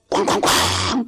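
high, drawn-out "kwang" booms; imitated booming blasts. Preacher acts out the sudden rapture, booming blasts. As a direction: boom it out, stretching the last one.

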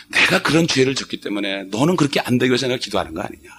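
normal talking voice

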